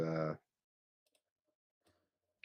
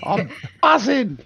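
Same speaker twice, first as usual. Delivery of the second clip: loud, high-pitched drugged elation; buzzing high; 12 dB louder than usual. Acted. Adventurer high on the drugs, acted buzzing excitement. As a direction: whoop it out like you're buzzing.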